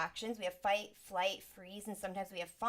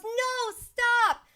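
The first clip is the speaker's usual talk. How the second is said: much higher and louder alarmed shout; mom yelling stop; 13 dB louder than usual. Retold or imitated. Mom shouts at child running across the street, alarmed. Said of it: "your mom goes".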